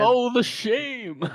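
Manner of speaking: very high, slow, loud mock dismay; sarcastic. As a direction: drip with fake pity. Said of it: "very sarcastic".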